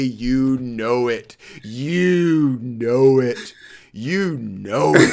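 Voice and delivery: slow, stretched you know it; drawn-out gloat. Real, not acted. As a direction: savor each you know it. Gleeful agreement, drawn out.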